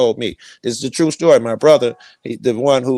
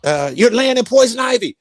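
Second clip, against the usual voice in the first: much higher voice for the passerby; high-pitched warning; 11 semitones higher than usual. Retold or imitated. Passerby telling the meditator about the poison ivy, much higher. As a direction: warn him, pitched well up. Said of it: "He was like".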